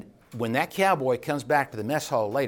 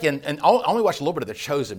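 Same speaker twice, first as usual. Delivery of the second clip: ordinary talk, nothing marked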